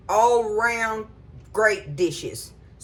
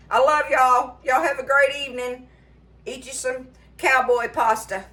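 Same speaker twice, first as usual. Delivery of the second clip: higher and louder, affectionate; warm sign-off; 5 semitones higher, 3 dB louder than usual. Real, not acted. Cook signing off to her viewers, warm and bright. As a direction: send love to the viewers.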